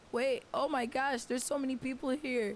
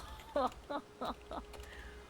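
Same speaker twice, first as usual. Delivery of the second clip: silent laugh; 7 dB quieter than usual. Real, not acted. Overwhelmed by the raid.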